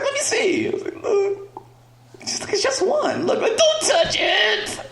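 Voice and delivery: high-pitched, laughing woman's voice; a high, giggly mock wife. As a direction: play the wife high and amused.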